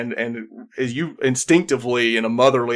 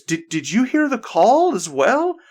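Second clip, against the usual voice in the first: tentative amazement; 11 semitones higher than usual. Acted. Game master acts amazed character asking.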